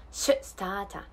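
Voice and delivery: "start a" as "stah-ta", clear T; slow model of "start a" without R; Australian pronunciation. Shown as a demonstration. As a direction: stop the T in "start a", open final "ah". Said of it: "せーの。".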